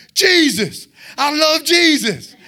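high, slow, emphatic shout of faith; excited declaration. Shown as a demonstration. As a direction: proclaim it with fired-up zeal. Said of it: "That's how excited you're supposed to be".

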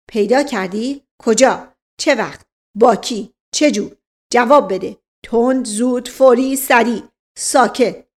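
loud, punched single-word questions; rapid-fire interrogation. Acted. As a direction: fire questions like a bullying inspector. Inspector fires questions at servant.

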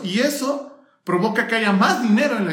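ordinary delivery

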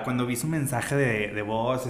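ordinary delivery

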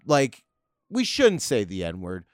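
ordinary talk

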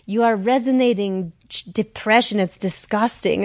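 a light imitation of a blunt French woman; a hint of French accent, matter-of-fact. The French psychic tells her she looks depressed, a hint of French accent. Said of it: "She's this French woman".

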